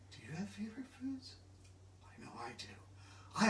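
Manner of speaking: fast, soft secret whisper. Acted. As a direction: share a secret with the child. Secret aside to the child.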